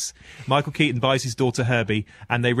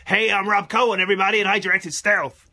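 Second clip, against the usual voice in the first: hyped-up shouted impression, loud and high; Rob Cohen shouting; 8 semitones higher, 3 dB louder than usual. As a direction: shout it like a full-on extreme sports nut. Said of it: "he shouts at you".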